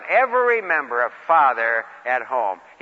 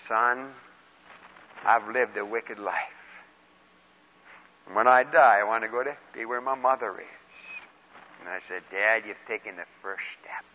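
quiet, low deathbed voice; hushed and solemn; 7 semitones lower, 7 dB quieter than usual. Retold or imitated. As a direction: speak softly at a dying man's bedside. Deathbed scene with dying father.

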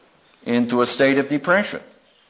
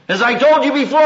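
ordinary delivery

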